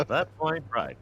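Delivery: pinched throat Hank Hill line. Shown as a demonstration. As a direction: squeeze the vocal cords for Hank. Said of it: "when I do the Hank".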